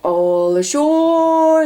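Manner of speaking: long, high called-out shout. A narrator's reading. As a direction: sing it out to the whole dock. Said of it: "the men shouted".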